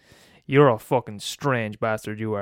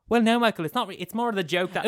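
usual speaking voice

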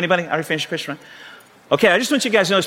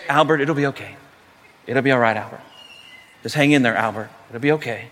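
calm dad; 7 semitones lower than usual. Retold or imitated. Dad soothing himself while his boy screams, calmly. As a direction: keep it unruffled, low and measured, each phrase gently falling. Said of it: "the dad's just calmly".

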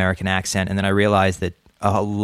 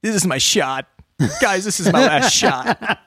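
high, laughing, mock-dramatic plea; mock earnest; 10 semitones higher than usual. Telling friends why he takes the game seriously, in hammed-up excitement. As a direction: ham up a big chance. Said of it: "I said".

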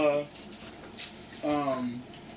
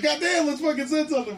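higher, eager mock urging; excited; 10 semitones higher, 3 dB louder than usual. Friends urging each other to reply, excited.

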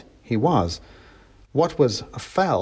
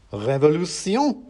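revolutionary mouse's cry; 5 semitones higher, slower than usual. A narrator's reading. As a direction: proclaim it as a tiny revolutionary. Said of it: "cried the mouse in the sock".

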